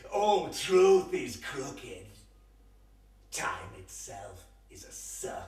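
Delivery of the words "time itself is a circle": whispered dwarf. Acted. The dwarf whispers his riddle to the wanderer. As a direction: breathe it out like a riddle.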